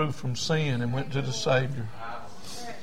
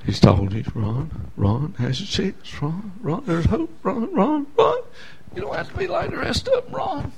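pleading inner voice; 6 semitones higher than usual. Retold or imitated. Voices inner pleading, calling his name.

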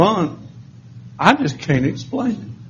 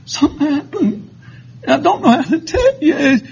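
tearful; 10 semitones higher than usual. Retold or imitated. Retelling his confession to Dewey.

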